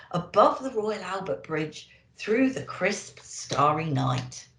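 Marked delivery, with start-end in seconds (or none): none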